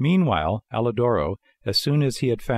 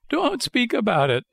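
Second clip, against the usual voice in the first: very high puppet wail; frightened wail; 14 semitones higher than usual. A narrator's reading. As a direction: beg him to stop in a high, frightened voice. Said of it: "wailed Pinocchio".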